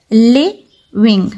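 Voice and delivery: Living slowly broken as "li - ving"; split into two syllables. Shown as a demonstration. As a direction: say living in two separate beats. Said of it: "टू parts में करके बोलते".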